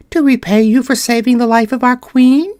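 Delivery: high little mouse voice, eager to help; a mouse offering thanks; polite big mouse. Acted.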